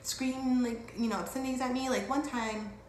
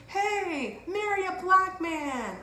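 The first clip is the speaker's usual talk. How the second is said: high, drawn-out imitated holler; mock scream; 10 semitones higher, 4 dB louder, much slower than usual. Stranger hollering at her as she rides on, high mock scream. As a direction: imitate a heckler yelling, pitched way up. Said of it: "Then he screams".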